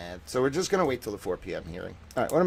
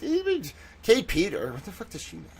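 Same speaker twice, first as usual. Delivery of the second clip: 1 scornful dismissal; 6 semitones higher than usual. Retold or imitated. His own dismissive inner voice.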